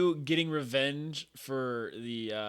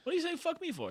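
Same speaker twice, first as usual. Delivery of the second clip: high mock quote; 3 dB quieter, faster than usual. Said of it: "quoting player".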